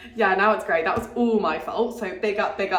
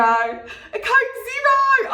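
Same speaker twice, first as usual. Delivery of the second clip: "A Coke Zero!" drawled slowly in a mock Australian accent, much higher and louder, with a long stretched "Zero"; 12 semitones higher, 5 dB louder, much slower than usual. Shown as a demonstration. British vlogger newly in Adelaide trying out an Australian accent. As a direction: ham up an Australian twang on "A Coke Zero!", slowed right down. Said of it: "I'm in Australia now, so I can actually say it like that".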